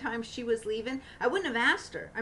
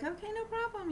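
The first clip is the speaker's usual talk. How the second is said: bright, much higher cheerful okay; mock cheerful reply; 13 semitones higher, 4 dB quieter than usual. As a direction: toss it off lightly, high and chirpy. Her casual reply, retold.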